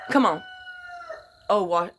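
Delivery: normal talking voice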